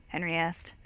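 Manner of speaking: usual speaking voice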